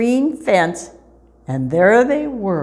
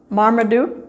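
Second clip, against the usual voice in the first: plain reading voice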